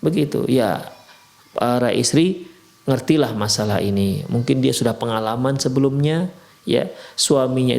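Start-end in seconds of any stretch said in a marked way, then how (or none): none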